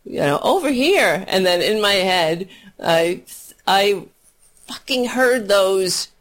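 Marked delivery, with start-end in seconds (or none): 0.4-1.2 high call. Retold or imitated. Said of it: "When I said, you know".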